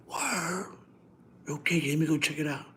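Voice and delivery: quick hushed reply like a mob guy; hushed mafia reply. Retold or imitated. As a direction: Flip answering in a quiet mafia voice. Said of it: "they talk low".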